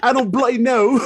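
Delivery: loud, high-pitched mock British accent; bad British accent. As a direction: exclaim it in a badly faked British accent. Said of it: "no worse than your accents".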